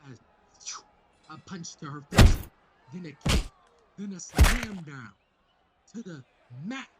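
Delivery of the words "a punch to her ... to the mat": hyped fight reading. Real, not acted.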